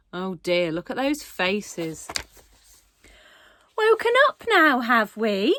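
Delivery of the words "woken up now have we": high, chirpy and sugary sweet; bright dentist voice. A narrator's reading. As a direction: greet him brightly like a cheery dentist. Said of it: "said Miss Root brightly".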